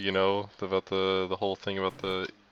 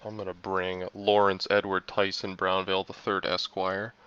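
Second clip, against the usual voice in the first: ordinary delivery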